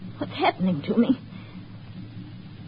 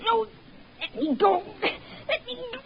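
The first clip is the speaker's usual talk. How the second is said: high, panicked plea to be let go; frightened pleading; 12 semitones higher, 8 dB quieter than usual. Acted. Wife pleading with husband to release her, high and frightened. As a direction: struggle free, frightened.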